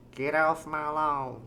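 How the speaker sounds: put-on slow, tentative old person voice; lightly voiced old lawn-guarder; feeling out an old person voice. Shown as a demonstration. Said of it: "forgetting how old people talk".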